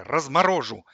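loud one-word threat; threatening. A narrator's reading. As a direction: make it a loud warning. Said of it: "пригрозил Костя". (угрожающе, громко)

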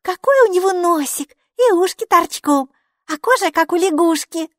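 high, delighted gushing over a tiny creature. A narrator's reading. Girl gushing over the elf in a jar, delighted. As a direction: coo with delight at the little face. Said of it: "заулыбалась".

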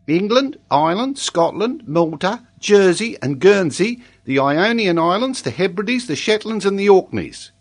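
high-pitched schoolboy rattling off his lesson; earnest young pupil, high-voiced; child pupil reciting.

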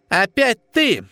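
high-pitched, sour annoyance; disgusted. A narrator's reading. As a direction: greet an unwelcome visitor with distaste. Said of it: "скривилась белая крыса".

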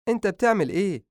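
high, anxious mother's voice; a mother alarmed, asking fast; mother startled by her son packing; worried mother. Acted.

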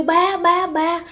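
put-on high, pleading child's cry, fading at the end; crying out in sleep. Child in a trance begging her father to take her, high crying plea. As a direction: call after him high, then let it sink. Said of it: "mà bạn kêu".

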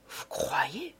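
half to himself, unsure; doubtful repeat. A narrator's reading. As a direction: judge repeating it softly to himself. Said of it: "répétait monsieur Filleul".

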